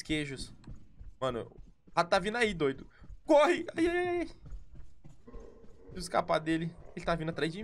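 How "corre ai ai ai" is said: sudden high scared shout; panicked yelp. Real, not acted. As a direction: yelp and run. Rat coming. (grito assustado)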